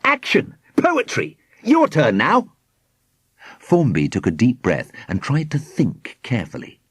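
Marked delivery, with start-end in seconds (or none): 0.0-2.5 high-pitched gleeful calls; triumphant cry. A narrator's reading. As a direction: show off, calling out each triumph. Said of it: "Squidgy cried triumphantly".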